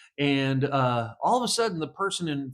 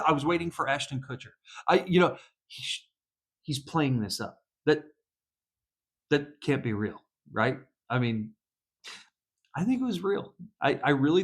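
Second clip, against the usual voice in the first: normal talking voice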